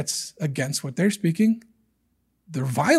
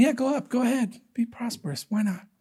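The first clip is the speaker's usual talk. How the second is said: sarcastic prophet; 5 semitones higher, 8 dB quieter than usual. Retold or imitated. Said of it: "can sense the sarcasm".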